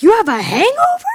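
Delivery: high, loud, quick swoop; mock incredulous question. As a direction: blurt it out in mock disbelief. Retold question, swooping up loudly.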